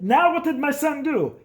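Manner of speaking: high mock dad demanding; lightly voiced angry father. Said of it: "and he's like".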